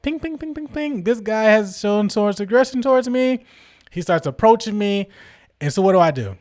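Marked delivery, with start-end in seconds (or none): 0.0-1.0 high, soft, rapid pings; high-pitched repeated alarm pings; alarm going off. Retold or imitated. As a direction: imitate a little alert bell in the head. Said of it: "brain starts going off".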